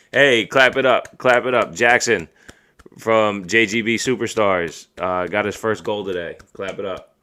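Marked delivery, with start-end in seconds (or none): 0.1-4.9 loud, higher cheering call-out; rally the listeners to applaud; hearty, raised-voice shout-out; calling for applause. Real, not acted. Cheering a child's first goal.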